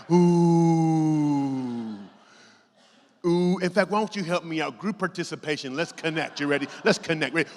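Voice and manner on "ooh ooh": loud, drawn-out crowd groan; crowd ooh. Retold or imitated. The crowd reacting on the Jumbotron, loud and long. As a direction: groan like a whole stadium. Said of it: "the entire crowd's like".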